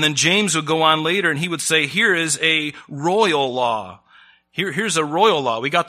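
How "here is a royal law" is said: slow, weighty proclamation of the verse; solemn scripture reading. Acted. Preacher to congregation, proclaiming the royal law slowly. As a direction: lay each word down slowly like a decree.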